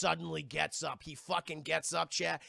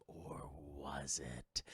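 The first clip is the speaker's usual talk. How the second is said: very slow, low whisper; mock-sinister twist question; ominous whisper; 11 dB quieter, much slower than usual. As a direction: drop to a hushed, drawn-out tease.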